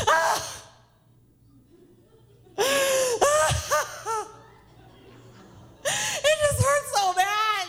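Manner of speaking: put-on high-pitched wailing child; hammed-up sobbing, words dragged out in agony; mock bawling. Sick son collapsing in the pharmacy line, mock wailing. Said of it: "Where they're, like, bawling".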